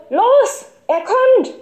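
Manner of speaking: very high, loud alarm shout; urgent bellow. A narrator's reading. Boy running in to warn the gang, bellowing. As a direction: yell to get everyone moving. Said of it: "brüllte".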